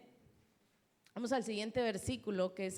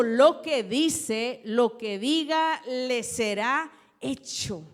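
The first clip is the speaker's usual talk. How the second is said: loud, slow preaching of the verse; preaching scripture; 2 semitones higher, 10 dB louder, much slower than usual. Acted. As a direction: proclaim each word to the congregation. Preacher reading scripture aloud.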